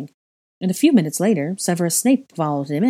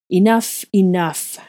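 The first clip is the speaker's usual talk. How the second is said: raised, louder enough, said twice; irritated interruption; 3 semitones higher, 6 dB louder, slower than usual. A narrator's reading. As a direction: cut them off firmly. Slytherin interrupts, frowning, irritated.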